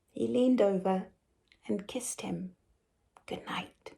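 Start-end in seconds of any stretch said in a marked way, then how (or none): 1.7-3.9 soft unvoiced whisper; hushed bedtime narration whisper. A narrator's reading. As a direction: whisper the goodnight.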